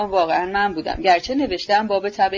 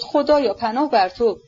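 plain reading voice

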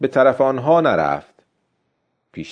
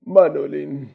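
slow, high, weary voice; defeated old man; 5 semitones higher, slower than usual. A narrator's reading. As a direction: admit defeat slowly, pitched up and weary. Defeated old man confesses to boy.

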